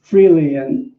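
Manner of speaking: leading the congregation line by line; measured, prayerful recitation; call-and-response affirmation. Acted. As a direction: minister leading a group affirmation.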